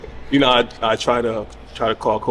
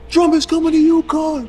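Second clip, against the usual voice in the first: high, loud, slow proclamation to the room; shouted announcement; 6 dB louder, slower than usual. Retold or imitated. Friend holding phone up to the crowd, slow loud shout. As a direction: belt it out to the whole party.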